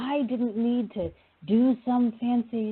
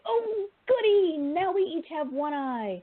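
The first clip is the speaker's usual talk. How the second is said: mock glee; 8 semitones higher than usual. Jesus jokingly asking if he handed over his eye, mock-gleeful. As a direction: squeal in sing-song fake delight, then ease down. Jesus mockingly imitates silly delight.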